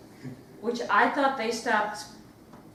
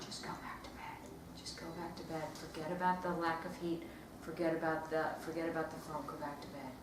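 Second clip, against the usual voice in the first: softer, lower near-whisper to herself; whispered self-talk; 6 semitones lower, 12 dB quieter than usual. Retold or imitated. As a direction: coax yourself back to sleep in a whisper. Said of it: "I, I think".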